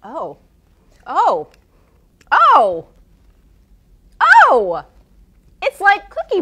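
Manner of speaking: building delight on each oh; excited. Real, not acted. Tasting a surprisingly good dip.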